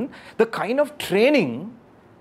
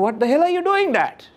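high, louder cry of disbelief from the son; exasperated son; 10 semitones higher, 3 dB louder than usual. Retold or imitated. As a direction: hurl it at dad, baffled and fed up. Son exasperated at father's dunking.

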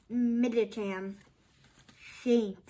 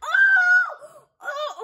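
gasp, then screaming oh, much higher and louder; ecstatic yells; 8 dB louder, slower than usual. Real, not acted. Pulling a rare card on camera, shrieking with joy. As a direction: lose it over the big pull. Huge card pulled from pack.

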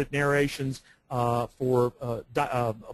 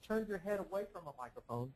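off-mic; 3 semitones higher, 15 dB quieter than usual. Shown as a demonstration. Showing a co-host how sound fades when you turn off-mic. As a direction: voice drops far off as the head turns from the mic. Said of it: "just like I did there".

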